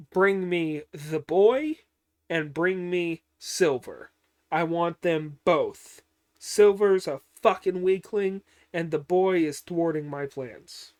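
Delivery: loud, forceful villain orders; mock-irate boss, punching the words; angry commander. Retold general's angry orders.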